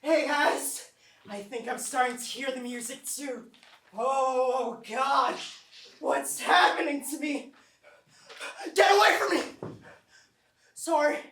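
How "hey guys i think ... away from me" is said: higher, louder, frightened cries; rising panic. Acted. Friend starting to hear the music, panicking. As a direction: build from alarm to a panicked yell.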